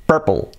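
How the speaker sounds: "purple" as PUR-pol; R-coloured vowel, dark L in "purple"; English pronunciation. Shown as a demonstration. Teacher revealing the English word, correct pronunciation.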